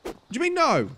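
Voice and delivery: high, loud, outraged; outraged shout. Real, not acted. Shouting at the player who won't recognise him, outraged. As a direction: yell it in disbelief.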